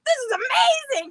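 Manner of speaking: high amazed imitation; high hammed-up amazement; husband amazed. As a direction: squeal it in amazement. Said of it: "And he was like".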